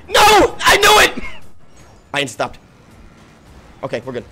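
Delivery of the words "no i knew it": high, slow yell of dread; panicked shout. Real, not acted. Racing from pursuers, he shouts in panic. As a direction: yell it out as the car explodes. Chased car about to blow.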